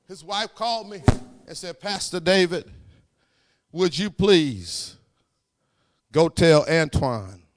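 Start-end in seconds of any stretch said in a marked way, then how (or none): 1.8-7.4 loud, slow, drawn-out plea; pleading call. Retold or imitated. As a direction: beg the pastor for help, stretched out. Wife's plea retold by pastor.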